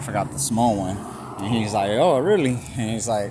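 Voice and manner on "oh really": louder, higher surprised cop; mock surprise. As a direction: react as the retold officer, mildly taken aback. Retold cop reacting with surprise.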